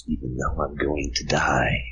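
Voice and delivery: low, breathless creepy whisper. Acted. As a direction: whisper it like a doomed victim. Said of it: "Speak up".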